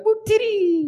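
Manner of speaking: squeaky, very high alien voice; Jawa voice. Retold or imitated. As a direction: squeal it like a tiny desert scavenger. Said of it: "they're like".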